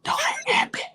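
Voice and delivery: unvoiced-sounding secretive whisper; imitating a hushed, urgent whisper; wife's whisper. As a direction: whisper a warning to your partner. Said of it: "So my wife keeps saying".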